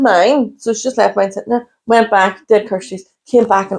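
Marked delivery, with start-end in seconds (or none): none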